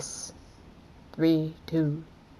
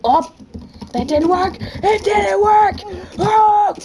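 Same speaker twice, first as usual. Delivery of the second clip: much higher, louder frustrated shout; frustrated yell; 14 semitones higher, 6 dB louder than usual. Real, not acted. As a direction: yell at the failed move. Game fails, yells.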